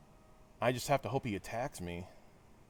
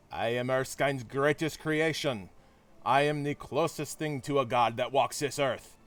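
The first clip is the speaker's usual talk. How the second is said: put-on crappy German accent; 2 semitones higher than usual. Red Skull boasting to Captain America, crappy German accent. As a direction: declaim it grandly in a bad German accent. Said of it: "in my crappy German accent".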